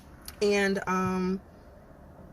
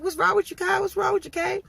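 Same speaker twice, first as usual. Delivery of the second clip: high-pitched, louder mimicry of nosy questioners; imitating concerned questioners; 11 semitones higher, 3 dB louder than usual. People questioning her about her face, high mock concern.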